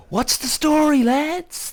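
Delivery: high, broad Cork greeting; Cork accent. Shown as a demonstration. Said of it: "That's what we say in Cork".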